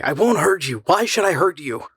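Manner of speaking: rising, pained reassurance; pained pleading. A narrator's reading. The man reassuring the terrified girl, pained and pleading. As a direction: plead gently but anxiously. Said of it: "he said painfully".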